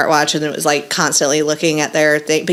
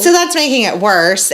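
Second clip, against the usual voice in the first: ordinary talk, nothing marked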